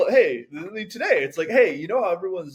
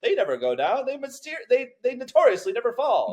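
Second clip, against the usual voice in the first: high, proud mock boast; hammed-up Titanic owner bragging; boastful shipbuilder; 10 semitones higher, 4 dB louder than usual. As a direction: brag the ship can never sink.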